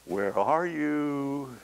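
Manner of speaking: high, slow, far-carrying shepherd's call. Acted. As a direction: call gently for a lost sheep, drawn out. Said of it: "a voice that says".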